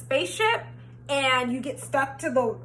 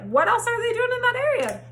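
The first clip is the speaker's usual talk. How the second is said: incredulous repeat; 10 semitones higher than usual. Retold or imitated. Repeated question, pitched up.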